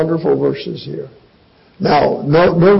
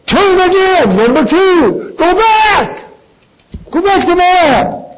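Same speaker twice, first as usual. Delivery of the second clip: pleading Naomi; 3 dB louder than usual. Retold or imitated. Preacher voices Naomi urging return.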